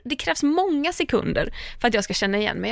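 ordinary delivery

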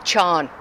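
"Sean" said as "chawn", for chewing; country "chawn" for chewing; Kerry pronunciation. Shown as a demonstration. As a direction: draw "Sean" into one syllable, "chawn". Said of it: "they say".

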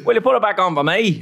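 put-on a second try at a Belfast accent, laughing through it; scene: retrying the chip shop line in a better Belfast accent. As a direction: redo the Northern Irish line, still giggling. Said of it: "That was better".

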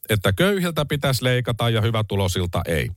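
ordinary delivery

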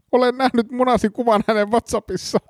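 high-pitched, overwrought suspect; epäilty, hätääntynyt. Acted. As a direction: pour out marital woes to the inspector, hammed-up. Suspect's tearful outburst in scene. (hätääntynyt epäilty)

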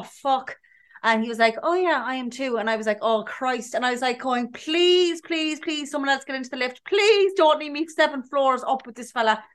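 usual speaking voice

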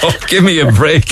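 high-pitched amused exasperation; cracking up in mock despair; laughing protest. Real, not acted. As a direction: plead through your laughter. Host still laughing at caller's joke.

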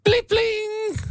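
very high pling pling like a text alert; imitated message chime; shrill phone ping imitation. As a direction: squeal the notification sound way up high. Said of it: "tekstiviestiääni".

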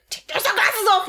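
high, loud exasperated shout of "off"; director's yell. Retold or imitated. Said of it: "when the director would yell".

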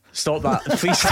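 usual speaking voice

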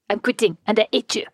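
put-on French accent, dropped H. Departing French employee to the boss, mock French accent. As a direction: imitate a French worker quitting. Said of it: "Speaking of am-, amazing French accents".

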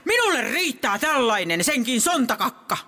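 high-pitched indignant tirade; exasperated scolding of a heckler; worked-up storyteller. Acted. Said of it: "älä kiihdy".

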